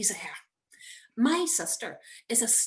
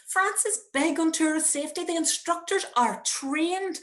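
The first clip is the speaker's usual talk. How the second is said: nervous reassurance; 8 semitones higher, 3 dB louder than usual. Retold or imitated. Mother still reassuring son.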